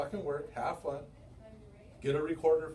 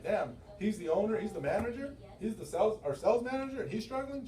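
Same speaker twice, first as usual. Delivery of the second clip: mock disbelief; 9 semitones higher than usual. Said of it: "be like".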